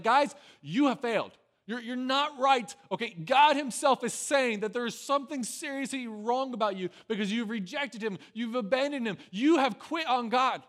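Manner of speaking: loud, high, emphatic rebuke; calling for attention. Retold or imitated. Prophet Amos confronting God's people, loudly calling for their attention. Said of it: "he's calling for the people's attention".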